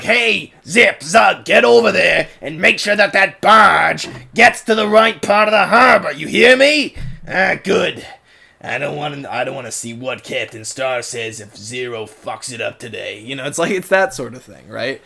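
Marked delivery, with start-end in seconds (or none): 0.0-8.1 loud, angry harbor boss shouting orders; Zoran. Acted. Harbor boss ordering Zip and Zug about, angry yelling. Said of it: "he's gotta talk something like this". 8.6-13.2 lightly gruff boss, dropping lower; Zoran. Acted. Boss warning the crew about Captain Star, low and gruff. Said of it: "he's gotta talk something like this".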